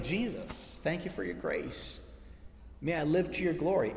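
a hushed thank-you under your breath; soft prayer. Pretend. Believer thanks Jesus after getting up, quiet prayer.

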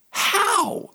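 sudden high disbelieving question; a high, puzzled how; incredulous how. Acted. Preacher voicing disbelief.

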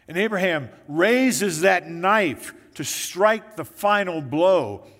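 slow down and boom the final blow; preacher's slow thundering climax; dramatic build. Acted. Climax of sacrifice story.

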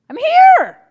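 put-on high, loud little boy's reply; small child calling back. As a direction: call back like a three-year-old stuck out of sight. Child answers from bathroom.

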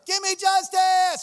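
loud, high, slow demanding shout; hammed-up widow crying for justice. Widow harassing the judge every morning, loud demanding cry. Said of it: "she's right there".

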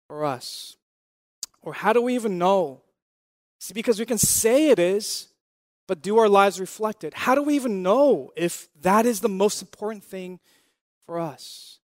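usual speaking voice

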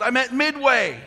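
high, loud daughter's reply; daughter's answer. Retold or imitated. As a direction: tell him plainly where she is. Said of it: "She goes".

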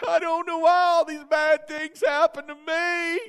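high, loud hammed-up wail; exaggerated mock lament; mock whining cry. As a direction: whine like a self-pitying victim. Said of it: "And then cry".

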